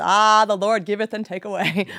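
loud, mocking recital of scripture; exasperated mock preaching; mock pious answer. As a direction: parody a useless pious platitude loudly.

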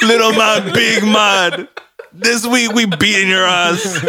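high, loud put-on Jamaican accent; hammed-up Jamaican threat, on the edge of laughing. YouTuber parody promising a beating, high Jamaican accent. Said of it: "'cause it's Jamaican".

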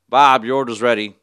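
very loud cashier call; calling out orders. Retold or imitated. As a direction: shout the order out across the counter.

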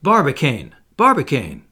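loud, urgent call of a name. A narrator's reading. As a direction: cry out to get his attention.

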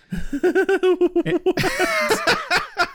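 long high burst of laughter; helpless high laughter. Real, not acted. Laughing at the punchline.

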